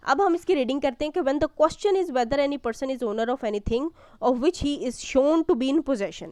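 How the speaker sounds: normal talking voice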